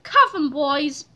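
plain reading voice

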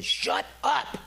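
high, exasperated mock shout; acted-out boy demanding silence; imitated brother's yell. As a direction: yell at your sister to hush. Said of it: "all you could really hear was David saying".

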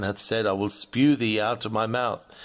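usual speaking voice

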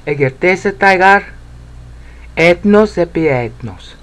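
Egertesetai gar, ethnos epi ethnos read in Greek; full Greek phrase. Shown as a demonstration. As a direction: read the whole Greek line through. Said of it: "That's the best I can do".

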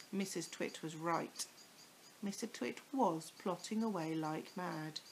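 ordinary delivery, nothing marked

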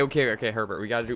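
ordinary delivery

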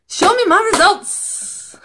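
high, drawn-out call; mock-dramatic demand. Quiz taker calling for the feminist score, playfully dramatic.

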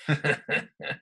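a short pantomime-villain snicker; villain laugh. Shown as a demonstration. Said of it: "I'm sure you could probably do his laugh".

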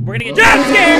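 high, loud startled yell; startled shout. Real, not acted. Gamer caught by a jump scare, startled shout.

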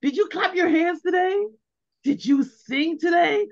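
bright and bouncy, asking the little ones; cheery questions to a small child. Retold or imitated. Parent asking little kids about their school day, upbeat and eager. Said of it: "you can say".

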